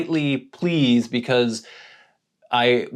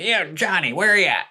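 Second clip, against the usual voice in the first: imitating homeless man; 8 semitones higher than usual. Homeless man insists on Johnny.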